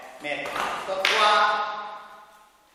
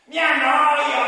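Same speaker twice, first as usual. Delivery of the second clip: shouted bored puppet; 11 semitones higher, 10 dB louder, much slower than usual. Acted.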